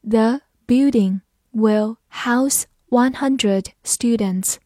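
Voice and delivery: slow, careful word-by-word read. Shown as a demonstration. Teacher reading slowly for students. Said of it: "慢慢来读".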